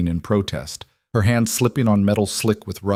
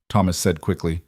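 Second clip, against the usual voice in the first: usual speaking voice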